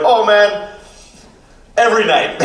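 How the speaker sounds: high-pitched put-on Jamaican accent; imitated Jamaican accent. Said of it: "he was kind of Jamaican".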